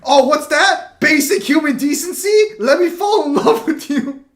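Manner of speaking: high, loud mock infatuation; smitten harem character. Mocking harem anime tropes to friends, exaggerated swooning. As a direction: gush like a hammed-up anime love interest.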